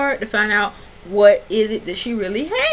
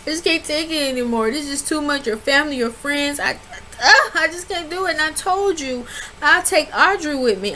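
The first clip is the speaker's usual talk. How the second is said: on the verge of tears; 6 semitones higher than usual. Retold or imitated. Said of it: "he look like he about to cry".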